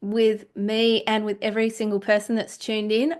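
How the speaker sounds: ordinary talk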